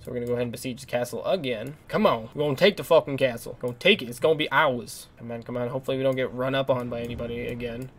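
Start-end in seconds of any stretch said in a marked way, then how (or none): none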